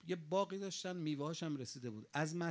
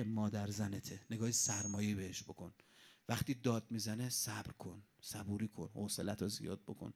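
grave counsel; 6 semitones lower than usual. Retold or imitated. Master's grave counsel to disciple.

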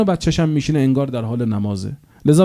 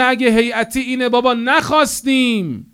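mock disgusted rejection; 11 semitones higher than usual. Disillusioned friend quoted, mocking.

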